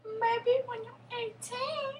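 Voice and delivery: drawn-out high teasing voice; high, slow girly voice. Retold or imitated. Girl's reply voiced.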